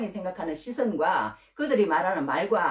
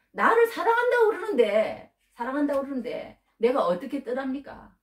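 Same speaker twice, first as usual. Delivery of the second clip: much higher, louder pleading voice; woman's plea; 7 semitones higher, 4 dB louder than usual. Retold or imitated. Preacher voicing the woman explaining why she stays. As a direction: plead as the trapped woman who can't leave him. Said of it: "그렇게 얘기를 한다는 거예요".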